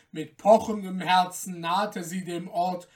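ordinary delivery, nothing marked

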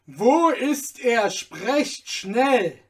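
loud, slow, emphatic demand; urgent bellowed questioning; impatient shout. A narrator's reading. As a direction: shout each word as an order. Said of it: "schrie der Sklave aufs Neue".

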